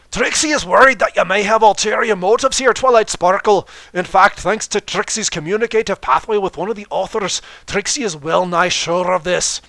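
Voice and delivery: loud, high, theatrical third-person boast; booming grandstanding showmare voice; boastful showman Trixie. Acted. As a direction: proclaim it like a stage magician showing off.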